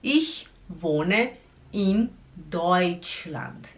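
ICH, WOHNE, IN, DEUTSCHLAND, each separated; very slow "Ich wohne in Deutschland" with pauses; slow word-by-word. Shown as a demonstration. Teacher breaking the sentence down for learners, slowly.

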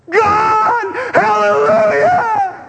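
big, high, drawn-out cheer like a sports fan; stadium-style shout. Pretend. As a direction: belt it out like the crowd at a Laker game. Imagined cheering in church like stadium.

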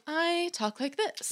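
slow, soft, high rich-person voice; airy and leisurely, with wealthy poise; super-rich affectation. Acted. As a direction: put on a moneyed, unhurried voice. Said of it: "a level of, like, astuteness and wealth".

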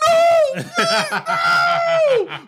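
high panicked shouts of no. Retold or imitated. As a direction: scream no in a panic. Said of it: "had a full-blown panic attack and was like".

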